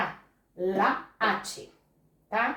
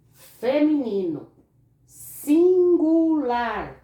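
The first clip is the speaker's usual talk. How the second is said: very slow, loud, higher stressed words; emphatic dictation; 5 semitones higher, 7 dB louder, much slower than usual. Shown as a demonstration. Teacher dictating key words while the class copies, very slow. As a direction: drag each word out for copying. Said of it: "Copiem bem".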